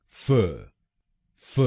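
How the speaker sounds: फ said alone, short and crisp; syllable demo. Shown as a demonstration. Teacher isolating the first syllable, crisp. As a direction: clip फ as a single sound. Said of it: "एफ़ ए हम बोलेंगे".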